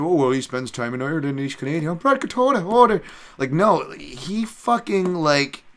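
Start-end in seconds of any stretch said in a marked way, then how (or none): 0.0-3.0 put-on high, loud garbled Irish-Canadian accent; mock Irish-Canadian accent. Mocking a fighter's odd speech, exaggerated Irish-Canadian accent. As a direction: ham up a muddled Irish-Canadian brogue. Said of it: "some weird fucking Irish and Canadian".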